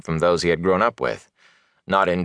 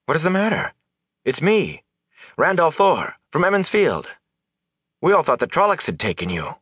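put-on higher-pitched, concerned young villager; earnest young man; 10 semitones higher than usual. Young villager to frightened peddler, earnest and concerned. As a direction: reassure him with eager, puzzled concern.

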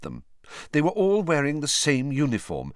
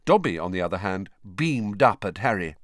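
plain reading voice

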